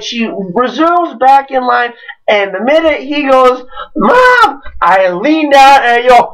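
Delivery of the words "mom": high crying call for mom; a kid wailing for his mother; whiny kid cry. Retold or imitated. A little kid wailing Mom at his mother.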